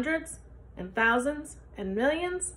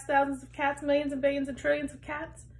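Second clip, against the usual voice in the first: plain reading voice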